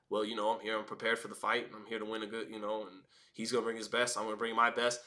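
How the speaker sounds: mock coach speak. Mocking boxers' stock pre-fight coach speak, low and soft. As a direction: mumble the stock pre-fight lines dutifully. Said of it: "everybody does the coach speak".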